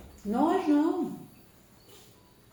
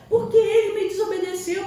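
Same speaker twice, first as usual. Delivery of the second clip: defensive parent; 9 semitones higher, 7 dB louder than usual. Retold or imitated. Imitated parent justifying hitting child.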